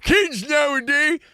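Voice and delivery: put-on high, slow old man voice; grandpa voice. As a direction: say it like a grumpy grandpa. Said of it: "You sounded like my grandpa".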